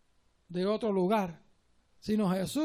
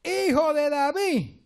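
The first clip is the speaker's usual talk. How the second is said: higher, louder, slow desperate plea; crying out; 6 semitones higher, 5 dB louder, much slower than usual. Retold or imitated. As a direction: shout for mercy like the blind beggar. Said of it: "Mucho más gritaba". (súplica a gritos del ciego Bartimeo)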